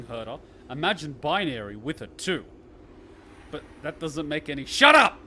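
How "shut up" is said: loud, high playful yell; shout. Real, not acted. Streamer shouts the game character down mid-sentence. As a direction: cut her off with a yell.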